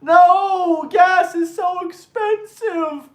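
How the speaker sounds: high, slow whining complaint; hammed-up high whine; mock whiny Democrat. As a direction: whine it like a caricature. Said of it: "screaming out the top of your lung".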